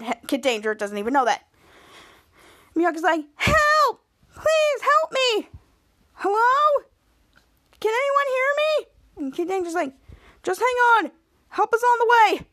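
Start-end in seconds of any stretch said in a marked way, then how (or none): 3.4-8.9 high damsel-in-distress cries; mock distress call. Trapped woman calls for help. 10.4-12.5 calling out reassurance. Retold or imitated. Rescuer calls down to trapped woman.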